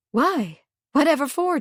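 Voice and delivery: taken aback, wanting to know why; exclaiming in surprise. A narrator's reading. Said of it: "she exclaimed".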